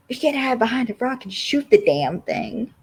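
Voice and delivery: mock-exasperated, high-pitched complaint; Alex. Alex objects to Jerry's shotgun idea, annoyed. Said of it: "Alex is getting annoyed".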